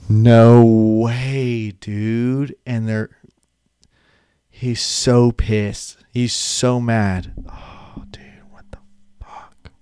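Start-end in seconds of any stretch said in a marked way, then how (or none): 0.1-2.5 stunned disbelief. Retold or imitated. Powerball number misses. 7.5-9.7 whispered, gutted disbelief; stunned whisper. Retold or imitated. Nearly-billionaire staring at his ticket, stunned whisper. Winner realizing he missed.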